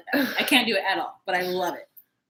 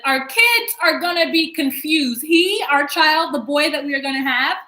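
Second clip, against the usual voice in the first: higher, louder, slow fretting; slow, louder mock-worried whine; slow whiny worried wife; 5 semitones higher, 9 dB louder, slower than usual. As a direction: drag it out like a fretting mother. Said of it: "She's like".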